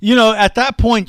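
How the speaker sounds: high, loud disbelief; can't believe they went back; incredulous. Real, not acted. Reacting to the butter thieves' arrest, in loud incredulous disbelief.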